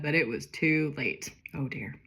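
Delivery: ordinary talk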